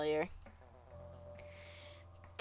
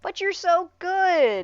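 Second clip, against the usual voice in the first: whiny pleading; 10 semitones higher, 6 dB louder, much slower than usual. Acted. Pleading character.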